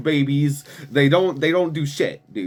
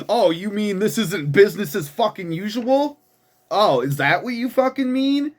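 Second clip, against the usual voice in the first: sarcastic retort; 6 semitones higher than usual. Real, not acted. Sarcastic reply to the quote.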